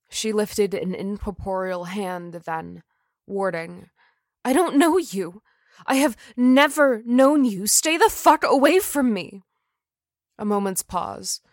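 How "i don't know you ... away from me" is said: loud, high fierce warning; angry. Acted. Jennifer's ghost warning Adrian off, loud and high. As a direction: push him away hard.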